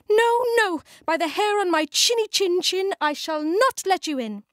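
high squeaky little pig voice; a small pig's high, defiant refusal. A narrator's reading.

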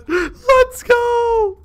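victory cheer that cracks up high; cracking triumphant yell. Real, not acted. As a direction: let the voice break on the cheer. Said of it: "I'm voice cracking right now".

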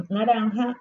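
ordinary delivery

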